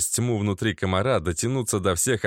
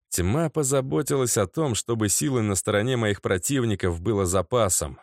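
plain reading voice

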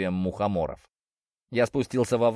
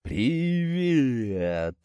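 very slow, drawn-out giant imitation; заторможенная речь; 3 semitones higher than usual. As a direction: stretch the word like a sluggish giant. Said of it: "Я попытался изобразить их заторможенную речь". (заторможенная, тягучая речь великана)

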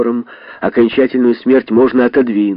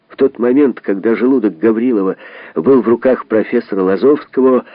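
plain reading voice

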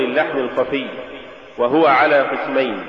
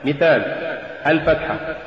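usual speaking voice

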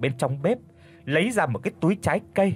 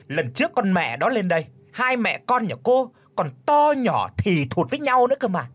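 shrieking tirade; 6 semitones higher than usual. Acted. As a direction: rattle off a screeching rant. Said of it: "rú lên rồi xa xả quát". (mẹ chồng rú lên xa xả quát)

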